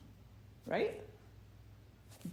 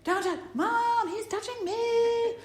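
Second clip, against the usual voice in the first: put-on much higher, louder, slow kid whine; whiny tattling little sibling; 13 semitones higher, 7 dB louder, slower than usual. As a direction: whine to Mom like a tattling child. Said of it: "And the other kid's like".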